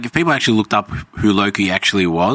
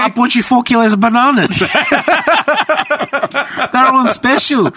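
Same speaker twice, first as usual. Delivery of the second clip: high, laughing impression of a fussy mum; laughing while imitating a fussy parent; laughing mock voice; 4 dB louder than usual. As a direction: giggle through it in a pitched-up voice. Mimicking someone, laughing through it.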